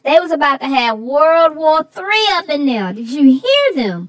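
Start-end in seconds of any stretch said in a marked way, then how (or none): none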